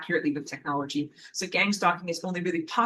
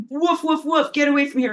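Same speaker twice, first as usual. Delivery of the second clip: high, quick mock woofs; voicing a barking dog; 7 semitones higher than usual. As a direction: bark it out as the dog. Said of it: "she then has to be like".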